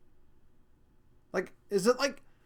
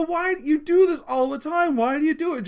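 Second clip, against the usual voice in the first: loud, high mock-exasperated questioning; exasperated parent; 9 semitones higher, 7 dB louder than usual. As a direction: act out a parent pleading for an explanation, loudly. Acts out exasperated question to daughter.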